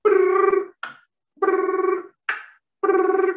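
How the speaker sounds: mock phone ringing; high mouth-made ring tone.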